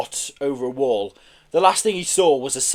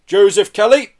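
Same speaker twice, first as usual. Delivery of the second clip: lightly voiced arresting officer, raised and firm; formal arrest, less booming than a hail; officer in charge; 7 semitones higher, 9 dB louder than usual. A narrator's reading. Officer addressing the man being arrested, officious. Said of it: "said the officer in charge".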